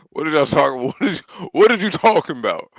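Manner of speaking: cracking up while asking; laughing disbelief. Real, not acted. Mocking the nine-case camp news.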